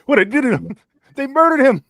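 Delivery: shouting, high and loud; outraged yell; loud outrage. Real, not acted. Outraged at the Barney balloon.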